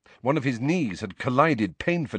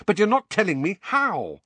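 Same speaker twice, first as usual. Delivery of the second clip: high, exasperated complaint; angry protest; 10 semitones higher than usual. A narrator's reading. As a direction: throw the blame back angrily. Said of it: "said Harry angrily".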